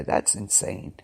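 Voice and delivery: breathy whisper, almost no voice. Real, not acted. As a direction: drop to a stunned whisper. Stunned aside to listeners.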